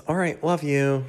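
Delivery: sudden cheerful goodbye; bright sign-off. Real, not acted. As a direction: pop back up cheerfully. Abrupt upbeat sign-off.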